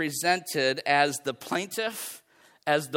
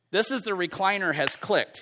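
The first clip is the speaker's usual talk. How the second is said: normal talking voice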